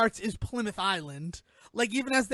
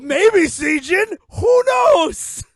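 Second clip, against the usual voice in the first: louder, much higher playful call; teasing shout; 7 semitones higher, 10 dB louder, much slower than usual. Real, not acted. Host teasing the co-host, loud high playful call. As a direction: tease her loudly with the name.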